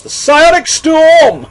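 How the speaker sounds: loud, high, drawn-out battle cry; booming announcement. Acted. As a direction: proclaim it like a super-weapon reveal. Excited announcing of a game upgrade.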